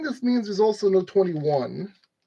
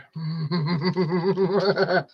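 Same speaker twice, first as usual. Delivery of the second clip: long, rolling chuckle; self-mocking chuckle. Real, not acted. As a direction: chuckle at your own silliness. Laughing at own silliness.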